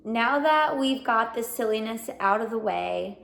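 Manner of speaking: ordinary delivery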